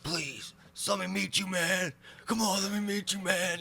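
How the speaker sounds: high whiny pleading; drunk begging. Retold or imitated. Drunk fan begging at the tour bus, high and whiny.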